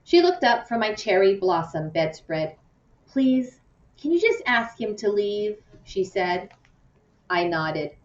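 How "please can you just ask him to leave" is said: higher, pleading voice; a tired hurt plea; pleading girl. A narrator's reading. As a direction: beg her friend to send him away. Lupe pleads to friend.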